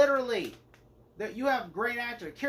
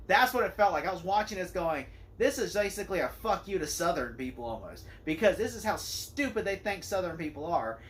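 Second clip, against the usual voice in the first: ordinary delivery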